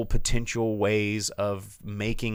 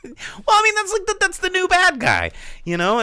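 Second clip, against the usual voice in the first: high, loud defensive burst; loud protest; 6 dB louder than usual. Real, not acted. Host defends the plot to shocked co-host, high insistent protest. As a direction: protest loudly over a laugh.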